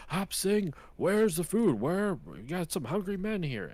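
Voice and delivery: high-pitched mock yell for the cook; imitating a yelling dad. Hungry dad calling his cook from the table, mock yelling. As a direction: holler like a hungry ranch boss, playfully. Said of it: "this is the dad yelling at Hop Sing".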